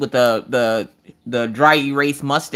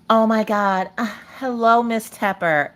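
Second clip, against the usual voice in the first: lightly voiced, higher-pitched teacher; mock teacher's strained greeting; imitated teacher; 5 semitones higher, slower than usual. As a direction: greet a dreaded parent as the teacher. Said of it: "had to contact the teacher".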